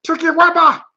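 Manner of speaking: high, loud cartoon creature squeal; Stitch. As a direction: shout like a little alien critter.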